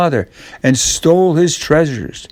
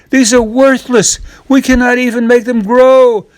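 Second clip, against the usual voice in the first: much higher, loud, despairing mother voice; upset mother's cry; 12 semitones higher, 6 dB louder than usual. A narrator's reading. Mother scolding Jack for the beans, high and upset. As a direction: wail it like a mother at her wits' end. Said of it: "cries his mother".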